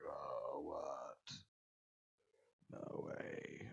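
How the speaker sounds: very soft, breath-only mock awe; imitated hushed amazement. Imagining viewers hearing the player's age, hushed mock amazement. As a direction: whisper it as imitated disbelief. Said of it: "You guys would be like".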